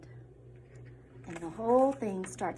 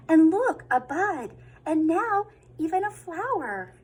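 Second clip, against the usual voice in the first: high, louder amazed reading; excited wonder; 7 semitones higher, 7 dB louder than usual. A narrator's reading. Reader showing children the bud and flower, excited. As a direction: point out the wonder.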